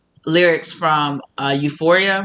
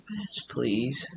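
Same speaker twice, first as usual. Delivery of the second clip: dismissive scoff; 5 semitones lower, 13 dB quieter than usual. Acted. Dismissive villain aside.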